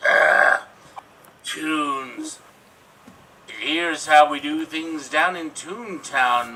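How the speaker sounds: low, slow, menacing villain voice; Judge Doom villain. Acted. Villain threatening Mister Valiant, low slow menace. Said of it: "I'm Christopher Lloyd".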